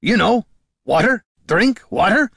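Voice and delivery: loud, high, word by word; insistent explaining. A narrator's reading. Dwarf miming water for the goblin, insistent. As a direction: spell it out for someone who doesn't understand.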